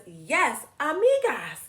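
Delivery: put-on excited girl character. Girl in the picture book answering yes to friendship. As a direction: pipe up happily as the little girl. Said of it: "And Susan says".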